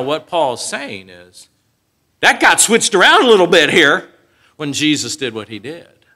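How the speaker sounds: ordinary delivery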